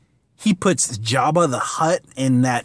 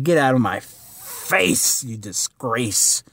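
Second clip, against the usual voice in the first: scornful emperor; 3 semitones lower, slower than usual. Retold or imitated. Palpatine dismissing Vader.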